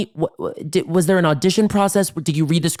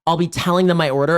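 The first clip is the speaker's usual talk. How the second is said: usual speaking voice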